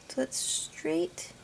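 hushed and careful, lining it up; whispered concentration. Real, not acted. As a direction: breathe it out while focusing. Concentrating while lining up stamp.

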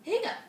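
squeaky jumped-up hiccup on the word; cute little piglet hiccup; high hiccup. Acted. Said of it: "But it didn't help".

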